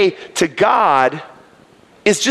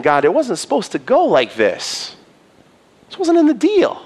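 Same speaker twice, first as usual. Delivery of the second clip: raised, pleading voice of Job; Job's lament. Retold or imitated. Job pleading with God, betrayed and hurt.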